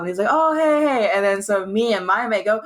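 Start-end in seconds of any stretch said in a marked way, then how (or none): 0.3-1.1 high, cheery imitated pub greeting; mild call-out. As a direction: mimic a mate calling out hello. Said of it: "it's a little bit loud in the pub".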